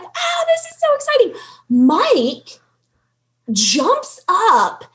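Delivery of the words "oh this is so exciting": high, thrilled squeal; freaking out. Retold or imitated. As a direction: gush with excitement. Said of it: "freaking out".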